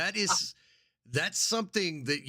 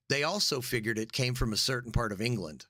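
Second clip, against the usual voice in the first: usual speaking voice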